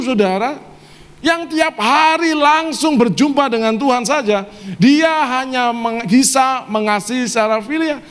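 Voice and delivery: high-pitched, drawn-out sermon delivery; emphatic preaching. Real, not acted. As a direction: preach it high, stretching the key words. Preacher builds emphatic point.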